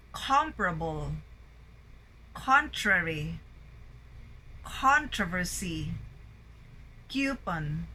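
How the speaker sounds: isolated words, strong start, falling tail; word-list drill, first syllables punched like COM in Comfortable; first-syllable stress. Shown as a demonstration. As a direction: drill "Comfortable" through "delicacy", each opening syllable strong. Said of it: "Words with a primary stress on the first syllable".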